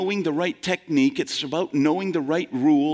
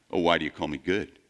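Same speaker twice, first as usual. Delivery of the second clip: lower, quick and offhand, brushing off the compliment; brusque dismissal; 10 semitones lower, faster than usual. Retold or imitated. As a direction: toss the question back without social graces. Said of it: "Can't you cooperate a little bit with some social graces".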